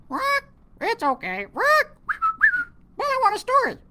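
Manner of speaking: high, squawky parrot voice; squawking parrot. Acted. Parrot squawking back at the pirate captain.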